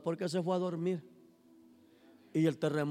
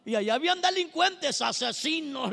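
ordinary talk, nothing marked

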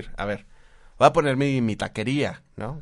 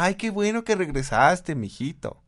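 higher, sugary mock motherly welcome; sweet mother; 7 semitones higher, 4 dB louder than usual. A son returns after years, the mother gushes in a higher mock-sweet voice. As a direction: coo it like a doting mom.

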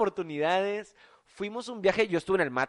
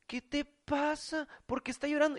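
higher, slow, soft faux-innocent voice; mock innocent girl; 8 semitones higher, 5 dB quieter than usual. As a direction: play dumb like the girl, sing-song. Mimicking girl's feigned innocence.

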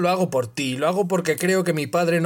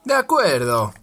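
firm, louder agreement; closing a deal; 3 semitones higher, 4 dB louder than usual. A narrator's reading. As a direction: seal it like a deal. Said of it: "como cerrando un trato".

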